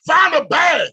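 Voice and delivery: high, loud order; shouted command. Real, not acted. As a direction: bark it at the crowd.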